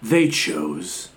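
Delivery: lightly imitated trailer announcer, slow with dramatic pauses; movie trailer voiceover. Host quotes the trailer's line to the co-host, mock trailer voice. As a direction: draw out each phrase like a mock trailer narrator. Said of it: "doing the trailer voice".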